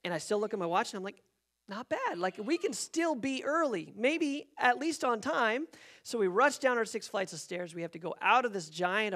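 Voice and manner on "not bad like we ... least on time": high, drawn-out optimism; cling to hope, stretching the words; hopeful. Retold or imitated. Checking watch, hoping still on time.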